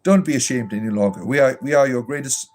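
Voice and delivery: syrupy, sing-song reassurance, hammed-up; mock pastoral comfort. A mock pastor comforting Robert, syrupy and over-sweet. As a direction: lay the fake sympathy on thick like a gushing pastor.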